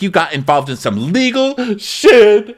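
high, drawn-out retold accusation; mock yelling. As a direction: imitate a castmate yelling, stretching the last words. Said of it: "Candace is still, you know, yelling".

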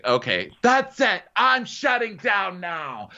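high, drawn-out announcement; mock exasperation. Host mimics Lisa bailing out of the fight, mock exasperated. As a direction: declare it in a stretched, put-on huff. Acted Lisa giving up on quarrel.

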